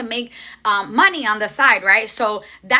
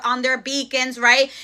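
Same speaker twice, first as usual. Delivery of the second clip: ordinary talk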